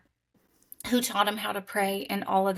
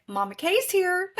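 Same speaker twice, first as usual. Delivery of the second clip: cheerful greeting; 10 semitones higher than usual. Retold or imitated. Voicing Mama Kay's greeting.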